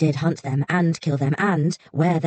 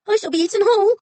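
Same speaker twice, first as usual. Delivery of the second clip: crying fox; 5 dB louder, faster than usual. A narrator's reading. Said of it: "it started to cry".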